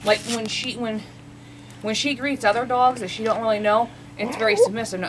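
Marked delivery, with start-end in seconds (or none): none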